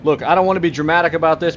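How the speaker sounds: normal talking voice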